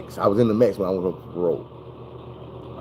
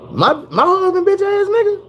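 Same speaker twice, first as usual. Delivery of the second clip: very high, shrieking shocked question; mock shrieking mom in disbelief; outraged disbelief; 4 dB louder than usual. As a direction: squeal it in stunned outrage.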